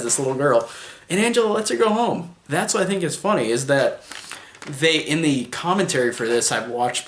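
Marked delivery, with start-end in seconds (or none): none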